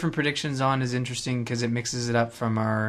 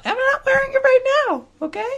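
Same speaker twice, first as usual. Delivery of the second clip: squeaky high mock of his "What?"; 4 dB louder than usual. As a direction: parrot him in a silly high pitch. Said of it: "I didn't even talk like that".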